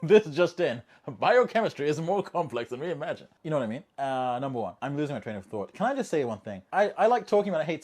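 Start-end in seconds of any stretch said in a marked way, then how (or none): 0.0-3.3 a touch of a news anchor breaking a story, louder; mock newsflash. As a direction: announce it like breaking news, tongue in cheek.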